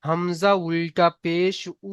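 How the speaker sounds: slow spelling-out, the last "উ" held long; drawn-out vowel. Shown as a demonstration. Teacher showing students the one-alif stretch, drawn-out "উ". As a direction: stretch "উ" steadily before stopping. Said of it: "শুধু এক আলিফ পরিমাণ টান হবে".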